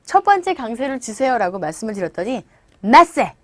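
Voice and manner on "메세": 메세 punched on the first syllable, final j left off; dropped ending. Retold or imitated. Imitating learners who overdo stress, 메세 cut before the j. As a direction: say message as a loud-fronted MESS-eh with no ending. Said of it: "뒤에 발음을 안 하세요".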